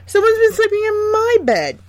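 very high dwarf cry of alarm; a small dwarf calling out in alarm. A narrator's reading. Second dwarf finds someone in his bed, high alarmed cry. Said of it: "the second one cried out".

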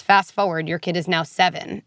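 ordinary delivery

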